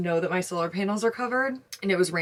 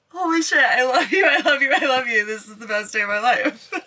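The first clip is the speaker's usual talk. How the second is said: loud, high, laughing thanks; overjoyed thanks after selling out; tearful joy; 4 semitones higher, 5 dB louder than usual. Real, not acted. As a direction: gush love at the audience through laughter. Trip sold out, thanking followers.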